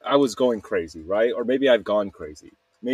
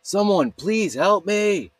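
retold screaming; 9 semitones higher than usual. Retelling their own night of screaming for help, mock scream. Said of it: "just screaming".

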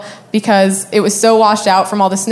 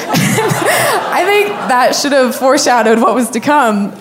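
amused, laughing through the first words; 4 semitones higher than usual. Real, not acted. Storyteller amused by her boyfriend's itchy complaint, laughing. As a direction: say it through a laugh, starting high and drawn out. Laughs at own retold punchline.

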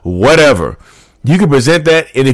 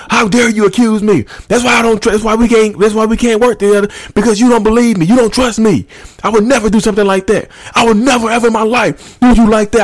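high-pitched, indignant narcissist rant; mock yelling; 11 semitones higher than usual. Accused narcissist turning it back on the partner, high mock yelling. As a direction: imitate an outraged accuser, pitched way up without real volume. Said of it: "is yelling and screaming at you".

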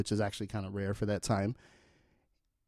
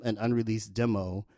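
ordinary talk

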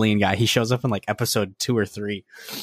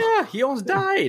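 very high, incredulous yelp; squeaky outburst. Real, not acted. Fan outraged over the hero's near death, very high. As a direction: shoot way up in disbelief. Excited outburst about show.

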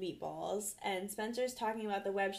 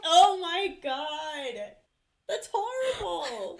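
laughing; 6 semitones higher, 7 dB louder, much slower than usual. Real, not acted. Reacting to a gross joke.

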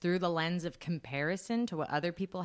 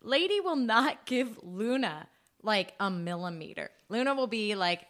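ordinary talk, nothing marked

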